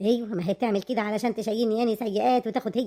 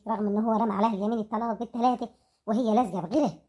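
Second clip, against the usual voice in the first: usual speaking voice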